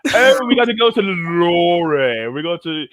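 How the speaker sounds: mock British accent. Throwing everybody off at a rally, mock British accent. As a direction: ham up British speech, trilling the R's. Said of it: "speaking exactly like this".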